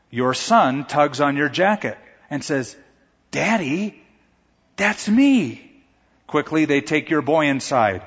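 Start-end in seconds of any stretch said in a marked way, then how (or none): 3.3-5.7 put-on child's voice. Son calls out in a story.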